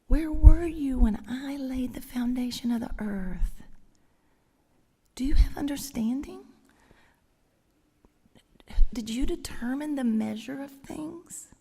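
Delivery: whispered gently instead of thundered; tender whisper. Shown as a demonstration. God whispering the questions to Job, gentle whisper. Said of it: "if I were to read it in the big voice".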